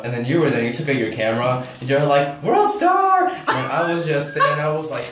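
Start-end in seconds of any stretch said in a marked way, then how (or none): 2.4-3.3 high, loud fight-filming holler; calling out. Retold or imitated. Friend filming the fight, high excited yell. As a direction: shout it across the crowd.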